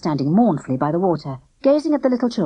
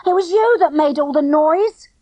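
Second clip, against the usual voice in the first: put-on high, louder accusing boy; indignant retort; 11 semitones higher, 5 dB louder than usual. A narrator's reading. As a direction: throw the blame back. Boy blames girl back.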